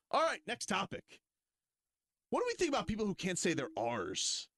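hammed-up host announcing; mock show host. Host moving to next topic, high.

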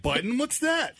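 high-pitched mock curious kid; carefree kid. Dad mimicking fearless kids, carefree and high-pitched. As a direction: play a clueless, unbothered button-pusher. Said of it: "They're like".

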